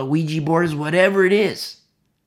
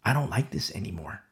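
quiet inner conviction; 9 semitones lower, 8 dB quieter than usual. Retold or imitated. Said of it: "we feel the conviction".

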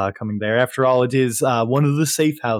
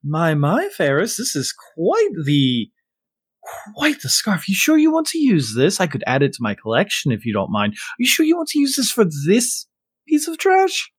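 character voice; 6 semitones higher than usual. Acted. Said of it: "and just go".

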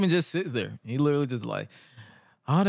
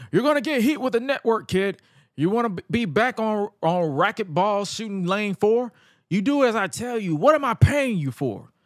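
high-pitched bossy scolding; Cyrus; 7 semitones higher than usual. Retold or imitated. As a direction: berate an underling who disobeyed. Acting out Cyrus's angry promo.

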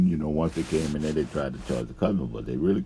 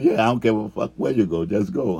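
loud, higher, impatient send-off; retold angry dismissal; 6 semitones higher, 6 dB louder than usual. Upset dude telling worker to just leave, angry. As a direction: act out a fed-up dismissal.

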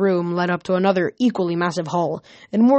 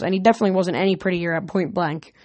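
ordinary delivery

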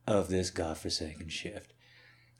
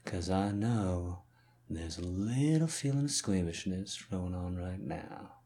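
ordinary delivery

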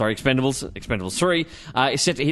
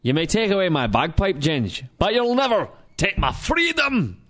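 put-on Scottish accent; 4 semitones higher than usual. Braveheart-style Scottish speech for listener Danielle. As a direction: ham up a Scottish rebel cry. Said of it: "you get a Scottish accent".

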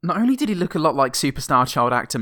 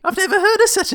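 squeaky high duck, laughing; 7 dB louder, faster than usual. Retold or imitated. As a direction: pitch it way up like a duck. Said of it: "he says".

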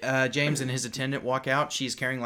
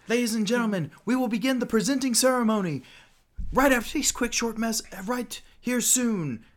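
raised ring announcer; 10 semitones higher, 3 dB louder than usual. Acted. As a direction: announce the ceremony to the crowd. GM as announcer again.

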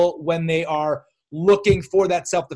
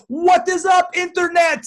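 put-on high, loud German-accented yell; imitating an excited German fan calling out; crazy German accent; 12 semitones higher, 4 dB louder, slower than usual. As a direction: shout it with a hammed-up German accent. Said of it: "in a crazy German accent".

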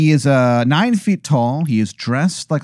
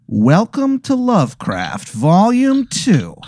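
drawn-out show sign-off, higher; slow announcer voice; 4 semitones higher, much slower than usual. Acted. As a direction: announce the episode title slowly. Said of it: "he goes".

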